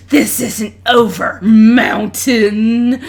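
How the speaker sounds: loud, slow vow of revenge; a touch of a sore loser spitting it out; parting threat. Acted. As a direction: wrestler swearing revenge on his rival, loud and deliberate. Beaten wrestler storms off.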